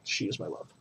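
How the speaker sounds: teary. Real, not acted. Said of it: "Sorry, I get a little bit teary".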